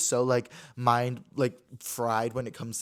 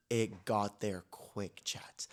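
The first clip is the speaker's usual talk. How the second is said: slow, whispered aside to the audience; confiding whisper; 6 semitones lower, 5 dB quieter, much slower than usual. Real, not acted. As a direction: whisper it slowly like a secret. Confides racy detail to chat.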